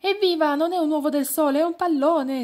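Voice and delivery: high, loud joyful shout; exclaiming. A narrator's reading. Pignetto cheering to the others, high and excited. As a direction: burst out with delight. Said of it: "esclamò Pignetto".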